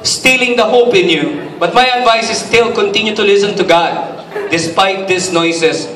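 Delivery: a pastor at full lift; preaching. Acted. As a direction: preach it out to the hall. Preaching at raised pitch.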